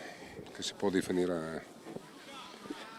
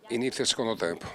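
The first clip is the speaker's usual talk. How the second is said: ordinary talk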